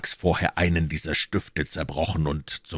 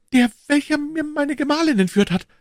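high, louder outcry; agitated accusation; 5 dB louder than usual. A narrator's reading. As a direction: accuse him in a high, raised voice. Bonacieux accuses his wife's abductor. (aufgeregte, hohe Anklage)

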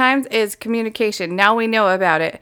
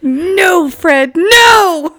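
higher, louder mock protest; disgusted cry; 7 semitones higher, 7 dB louder, much slower than usual. Real, not acted. Co-host recoiling from the Fred West joke, loud. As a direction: cry out in horror.

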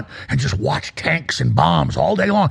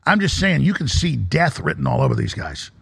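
usual speaking voice

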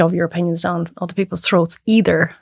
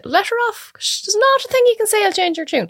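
higher, lightly voiced parents; parents' resigned voice; 12 semitones higher than usual. Parents telling each other to let her try, lightly voiced. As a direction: shrug her off, mock-weary. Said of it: "Mum and Dad will always say".

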